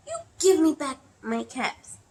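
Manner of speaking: scolding. A narrator's reading. Peddler shaking his finger at monkeys, scolding. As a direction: demand it high and loud. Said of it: "shaking his finger at them".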